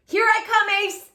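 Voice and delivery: loud excited hero call; eager call. Acted. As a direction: call out as you race to the rescue. Chase racing to rescue.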